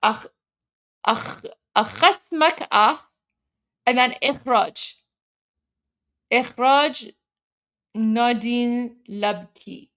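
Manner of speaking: Akhasmak A with a throaty kh, slow and halting; halting sounding-out. Shown as a demonstration. Streamer terrible with pronunciations reading Arabic, halting sounding-out. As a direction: sound out Akhasmak A, Ikhraj, Nadine Labki haltingly. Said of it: "I'm terrible with pronunciations".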